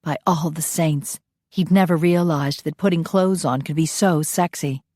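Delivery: plain reading voice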